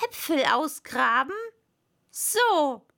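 loud, irritated echo; cross master's retort; annoyed. A narrator's reading. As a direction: snap back in disbelief at the servant. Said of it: "sagte das Kaninchen ärgerlich".

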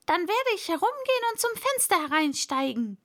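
high, quick character voice; lightly voiced fussy rabbit; rabbit talking to himself. A narrator's reading. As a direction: resolve aloud in a high, hurried voice.

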